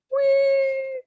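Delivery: high-pitched squeal of glee; a long, high "whee" for fun; playful whee. Real, not acted. Playful exclamation while skipping ahead.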